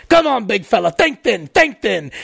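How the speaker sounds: Australian bloke egging someone on; imitated Australian. Australian in line to a fat rider, mock Australian cheering-on. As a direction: coach him along in an Australian accent. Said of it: "there was this Australian dude in line".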